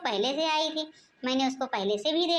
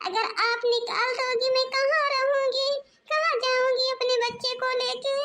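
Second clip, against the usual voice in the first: tearful pleading; 9 semitones higher than usual. Acted. Younger daughter-in-law begs mother-in-law for forgiveness, tearful. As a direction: plead not to be thrown out of the house.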